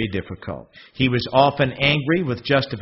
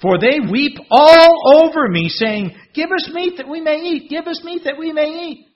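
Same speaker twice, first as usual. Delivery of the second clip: very high, pleading mock whine; high mock wail of hungry people; whining crowd; 12 semitones higher, 6 dB louder than usual. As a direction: mimic a begging crowd. Moses mimics weeping people.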